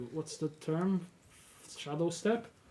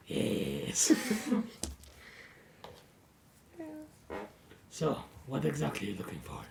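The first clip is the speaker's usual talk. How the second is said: lizard folk voice. Acted. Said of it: "wait, lizard folk voice".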